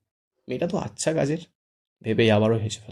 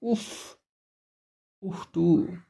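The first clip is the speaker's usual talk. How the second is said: annoyed; 7 semitones higher, much slower than usual. A narrator's reading. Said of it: "বিরক্ত হয়ে বলে".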